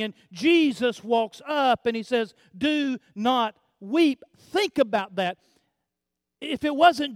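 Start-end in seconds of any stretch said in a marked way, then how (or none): 2.6-4.2 slow command. Acted. Jesus's words said slowly.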